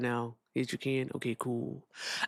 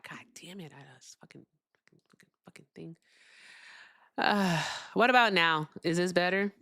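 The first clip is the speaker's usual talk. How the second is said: ordinary talk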